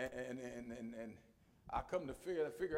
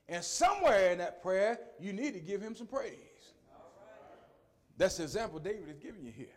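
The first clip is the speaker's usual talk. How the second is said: ordinary delivery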